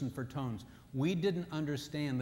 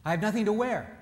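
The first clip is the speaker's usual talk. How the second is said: high, louder wife complaining; wife's voice; 6 semitones higher, 6 dB louder than usual. Retold or imitated. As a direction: say it like the wife who has nothing new. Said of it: "She says".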